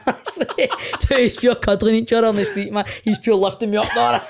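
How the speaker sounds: can't stop laughing while telling it; laughing through it. Real, not acted. Telling the host about the street hug, laughing hard. Giggling over a hug story.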